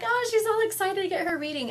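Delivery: high-pitched mock-excited singsong; playful imitation of gushing excitement; teasing. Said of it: "I'm just teasing you".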